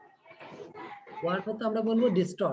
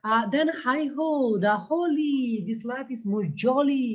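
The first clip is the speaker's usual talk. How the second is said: 1 poem recital; 4 semitones higher, much slower than usual. Shown as a demonstration. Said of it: "এবার বলেছে, খেয়াল করো তো".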